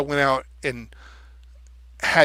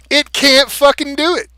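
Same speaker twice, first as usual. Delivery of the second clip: higher and louder, fed up; exasperated outburst; 10 semitones higher, 7 dB louder than usual. Real, not acted. Venting at the chatbot that can't rhyme, exasperated loud outburst. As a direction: blurt it out in exasperation. Frustrated rant about the AI.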